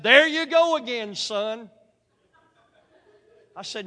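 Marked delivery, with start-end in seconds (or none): 0.0-1.7 loud, higher mock scold from a churchgoing lady; scolding old lady. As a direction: bark it out like a shocked elderly lady. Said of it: "She said".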